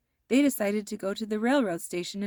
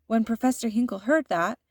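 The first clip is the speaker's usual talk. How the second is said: usual speaking voice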